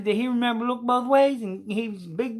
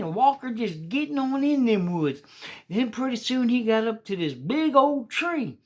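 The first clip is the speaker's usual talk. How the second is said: ordinary delivery, nothing marked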